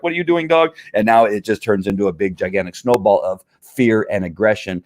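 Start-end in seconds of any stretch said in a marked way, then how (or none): 0.0-0.8 high-pitched, uneasy question; mock nervous dog owner, pitched up; fearful owner. Owner on the leash to the dog, acting fearful in a high voice. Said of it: "now acting fearful".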